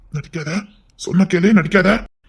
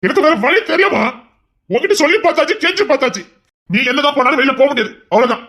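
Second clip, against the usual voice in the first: raised, higher pleading insistence; husband insisting, raised and higher, that she cannot leave; heated insistence; 6 semitones higher than usual. Acted. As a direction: push harder, voice climbing.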